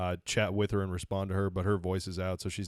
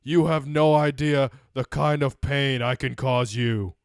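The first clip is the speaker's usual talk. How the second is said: loud, slow, higher menacing delivery; movie villain threat; 5 semitones higher, 8 dB louder, slower than usual. Acted. Quoting a film villain at a co-host, loud slow menace. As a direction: draw out the threat, loud and deliberate.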